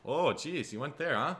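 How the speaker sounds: hammed-up Minnesota lilt, amused; Minnesotan. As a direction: play a taken-aback Minnesotan. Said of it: "even honestly in Minnesota".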